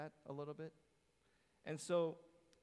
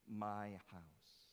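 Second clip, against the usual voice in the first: "My house." with the weight on "My", falling; stress on my; 4 semitones lower than usual. Shown as a demonstration. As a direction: lean on "My" as Jesus claiming it. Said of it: "Jesus is actually literally saying".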